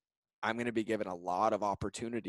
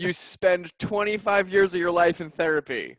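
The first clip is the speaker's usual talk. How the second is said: laughing answer; 8 semitones higher, 9 dB louder than usual. Real, not acted. Joking answer, laughter heard.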